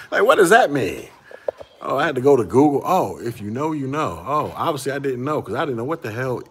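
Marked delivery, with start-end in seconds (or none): 0.1-1.1 high, loud, laughing through the words; laughing question. Real, not acted. Vlogger laughing at an acronym, loud and high.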